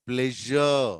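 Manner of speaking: ప్లెజర్ said as "plezh-uh"; teacher demonstrating zh in ప్లెజర్; model zh pronunciation. Shown as a demonstration. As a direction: linger on the buzzed zh inside ప్లెజర్. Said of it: "అదేవిధంగా".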